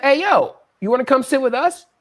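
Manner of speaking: calling out. Retold or imitated. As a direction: call him over to join you. Calling a stranger over to join.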